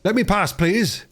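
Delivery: a runner out of breath asking to get by; panting. Acted. Running boy asking the children to let him pass, panting. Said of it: "a voice just behind them panted".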